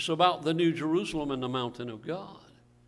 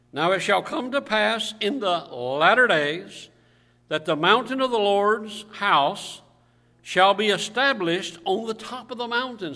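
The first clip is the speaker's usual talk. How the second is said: scripture reading aloud; 3 semitones higher, 5 dB louder than usual. Real, not acted. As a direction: proclaim the verse to the congregation. Reads scripture to congregation.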